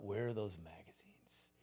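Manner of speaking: breathy whisper to himself; whispered thought. Retold or imitated. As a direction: whisper it like sneaking around the house. Boy sneaking through dad's house.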